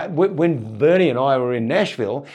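normal talking voice

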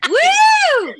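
loud, high whoop of delight; cheering woo. Real, not acted. Cheering the seventy-year-old guest with a loud, high whoop. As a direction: cheer her on loudly.

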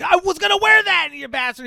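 high, loud sibling-squabble whine; mock outrage over a borrowed jacket; hammed-up brotherly yell. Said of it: "you're yelling at, like, your fucking brother".

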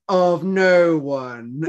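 slow, high, dramatic pause; mock-solemn reveal. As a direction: land it like a villain's twist. Acting out the girl's twist.

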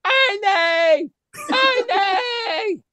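high, long-held mimicked screech; Ernie's scream. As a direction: call out the name way up high, drawing it out. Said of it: "Like that".

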